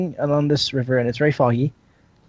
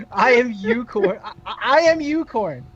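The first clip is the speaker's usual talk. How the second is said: mock grand announcement; 7 semitones higher than usual. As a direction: proclaim the silly name grandly, pitched up. Mocking the name, repeating it.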